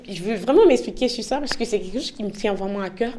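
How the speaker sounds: ordinary talk, nothing marked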